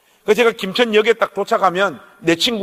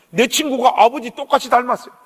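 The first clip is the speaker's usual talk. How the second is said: usual speaking voice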